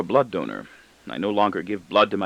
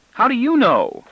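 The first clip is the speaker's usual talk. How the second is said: put-on boy's voice; 14 semitones higher, 5 dB louder than usual. A narrator's reading. Boy voiced by narrator.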